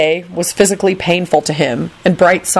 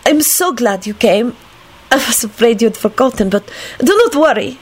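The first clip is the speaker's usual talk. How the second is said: put-on higher, careful foreign-accented voice; enthusiastic woman; 6 semitones higher than usual. A narrator's reading. Lady welcomes the young man, enthusiastic. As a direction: greet him keenly in an accented lilt. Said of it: "Cosmina replied enthusiastically".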